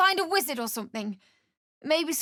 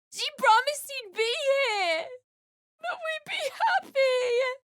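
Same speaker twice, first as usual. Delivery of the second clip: higher, tearful shout; crying outburst; 7 semitones higher than usual. Acted. Betrayed wife crying that she was promised happiness. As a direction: sob out the broken promise. Wife's hopes broken.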